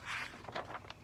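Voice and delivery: soft, breathy gasp; storyteller's hushed gasp. Acted. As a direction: gasp in suspense. Suspense before reveal in story.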